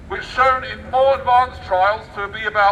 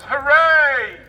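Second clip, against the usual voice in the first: mock cheer; 6 semitones higher, 4 dB louder than usual. Sarcastic cheer.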